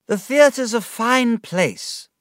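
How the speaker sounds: put-on high, slow drunken cry out. A narrator's reading. Drunk young man crying out about the theatre, high and slow. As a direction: drunk David calling out, high and drawn out. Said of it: "I cried".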